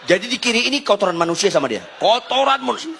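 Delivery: high, mock-horrified exclamation; aghast. Husband appalled by wife's handrail theory, high mock horror. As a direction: build to a high cry of disbelief.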